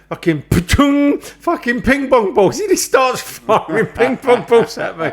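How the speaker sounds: high, drawn-out voice with ricochet noises; hammed-up exasperation, slow and pitched right up; retold comic alarm. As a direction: report being under fire, half laughing. Acts out dodging balls on walkie-talkie.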